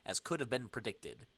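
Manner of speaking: ordinary talk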